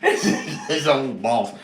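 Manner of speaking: laughing. Real, not acted. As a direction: giggle the words out. Laughing while repeating.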